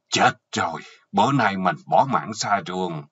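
plain reading voice

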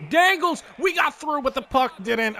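high, bright burst of hype; excited call. Real, not acted. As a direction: hype up the move. Excited dangle in game.

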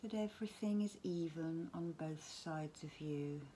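guided relaxation. Acted. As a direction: gently talk them down towards sleep, phrase by phrase. Leads a bedtime relaxation.

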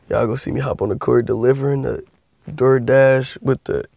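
normal talking voice

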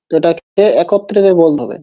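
ordinary talk, nothing marked

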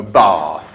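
BATH as "bahth", dropped low; long vowel "bath". Retold or imitated. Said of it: "all the kids go".

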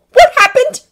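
high, loud, urgent demand; breathless demand. A narrator's reading. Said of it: "he demanded breathlessly".

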